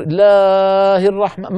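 الله held far too long on a high note; wrongly overstretched recitation. Shown as a demonstration. Teacher mocks a mistaken reading, الله overstretched. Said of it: "ما يصح يا أخي".

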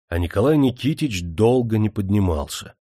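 plain reading voice

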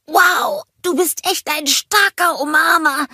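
high snowman voice, slightly pressed; a bit squeezed. A narrator's reading. Olaf squeezed in the monster's hug, slightly pressed. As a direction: talk while being hugged too tight. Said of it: "sagte Olaf etwas gepresst".

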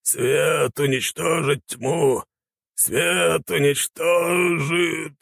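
high, very slow chant; entranced holy warrior's drawn-out mantra; dazed zealot. A narrator's reading. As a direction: intone it high, stretching each word. Old light warrior drones his mantra.

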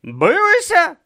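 high, loud, incredulous; appalled high-pitched questioning; shocked denial. Acted. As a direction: protest as if scandalised by the question. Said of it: "вирази різних ступенів потрясіння і жаху".

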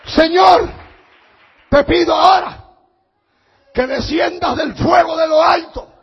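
a prophet crying out loud to God before the people; fervent prayer. Retold or imitated. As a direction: call down fire from heaven at full voice. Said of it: "Y entonces oró".

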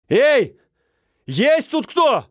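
loud high call into emptiness; shouting over wind. Acted. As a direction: shout out to the empty houses. Calling out again.

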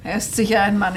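usual speaking voice

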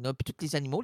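usual speaking voice

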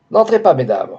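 men at the door warn the women off, hurried shout. A narrator's reading. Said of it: "d'une voix précipitée leur cria".

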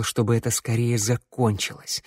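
ordinary delivery

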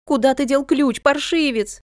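high-pitched frantic scolding; exasperated, voice pitched right up; angry demand. A narrator's reading. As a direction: demand an answer from a naughty child. Furious mother questions son about key.

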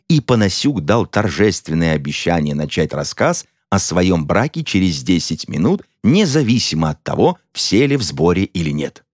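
usual speaking voice

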